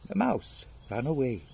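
ordinary delivery

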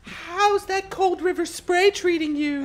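put-on high, slow old lady voice; creaky old woman, slow and high. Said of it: "There you go, that's good".

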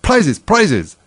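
high, loud cry from the crowd; loud, high-pitched calls; calling out. A narrator's reading. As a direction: clamour for it in short bursts. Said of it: "calling out in a confused way".

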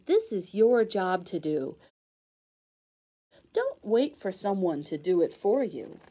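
lively, sing-song storybook read-aloud; reading to children. Acted. Teacher reading a rhyming book to kids, bright storybook voice.

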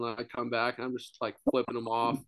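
ordinary talk

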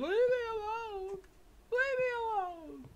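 high imitated woman's shriek; lightly hamming up a screaming lady; mock scream. Player imitating the screaming lady in the game, mock shrieking. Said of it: "one lady was still screaming".

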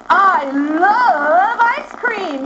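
drawn-out excited exclamation. Shown as a demonstration. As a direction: stretch it out with excited delight.

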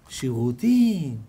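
low, slow, amazed repeat of the word; stunned question. Retold or imitated. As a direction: let the word hang in disbelief. Rich man stunned by toilet.

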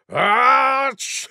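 high sneeze after a deep breath; big sneeze. Acted. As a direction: gasp in, then sneeze high. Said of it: "taking a deep breath".

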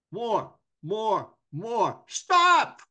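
high, drawn-out yells building to a loud stop; imitated coach's yell. Hockey coach yelling at his center, mock shouting. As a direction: holler it like a coach directing from the bench. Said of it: "he yells at his center".

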